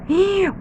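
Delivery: quick, high gasp of wonder; surprised gasp. Acted. Teacher gasping at the crown for her pupils. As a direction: breathe in sharply, amazed.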